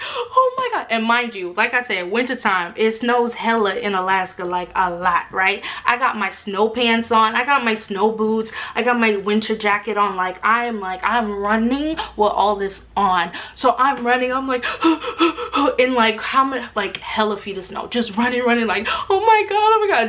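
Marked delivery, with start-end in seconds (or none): 0.0-0.9 panicked gasp. Retold or imitated. Fleeing a charging moose. 14.7-15.8 panicked gasp. Retold or imitated. Running from moose in snow. 19.1-20.1 high, loud retold panic; mock terror while running from a moose; panicked oh my God.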